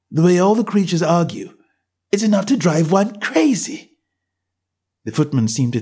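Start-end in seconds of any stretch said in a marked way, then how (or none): 0.1-4.0 put-on higher, polite girl's voice; a well-mannered girl asking; young Alice. A narrator's reading. Alice complains.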